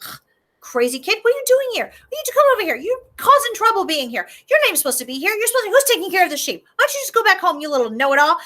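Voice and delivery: high, mocking older brother; scornful big brother. Eliab scolding little brother David, high mocking. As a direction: sneer at a pesky kid brother. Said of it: "gives his brother a look like".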